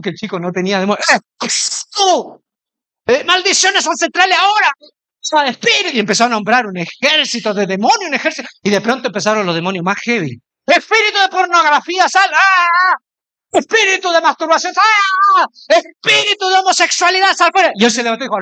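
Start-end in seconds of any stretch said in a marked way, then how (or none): 1.1-6.0 gritos agudos de orden; pastor exorcista imitado. Retold or imitated. Pastor nombrando demonios. 10.7-17.7 gritos agudos de exorcismo; pastor exorcista imitado. Retold or imitated. Pastor expulsando demonios a gritos.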